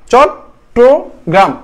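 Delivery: চট্টগ্রাম very slowly in three separate beats, chot, tro, gram; split into syllables. Shown as a demonstration. Teacher showing students the three syllables, চট্টগ্রাম said slowly piece by piece. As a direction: break চট্টগ্রাম into chot... tro... gram, a clear pause after each. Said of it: "তিন ভাবে হয়েছে না?".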